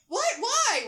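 high, loud startled cry; freaking out. A narrator's reading. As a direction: blurt it out, starting to panic. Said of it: "starting to freak out".

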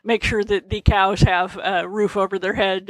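normal talking voice

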